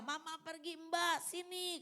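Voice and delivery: high, drawn-out child's whine, lightly mocked; imitating crying child. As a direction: act out a small child wailing for the nanny. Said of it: "Dia nangis".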